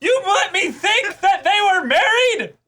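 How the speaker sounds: high, loud, slow accusing squeal; hammed-up betrayal, drawn out; mock outrage. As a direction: falsetto indignant shout at the cohost.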